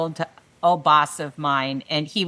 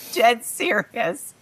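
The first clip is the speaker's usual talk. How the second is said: slow, flat-out certain boss reply; slow deadpan boss; 5 semitones higher, much slower than usual. Retold or imitated. Boss telling his employee, slow deadpan certainty. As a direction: drag out each word like a boss who means it.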